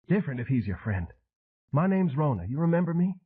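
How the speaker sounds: high, dazed Southern woman rambling on; wounded madwoman. A narrator's reading. Madwoman telling Elvis about her grief, high and wounded. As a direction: let her drift, high and hurt. Rona voice again.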